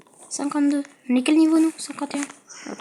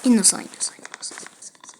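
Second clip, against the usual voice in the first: whispering; 3 semitones lower, 7 dB quieter, faster than usual. Acted. Pleading innocence in game.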